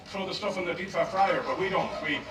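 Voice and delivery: normal talking voice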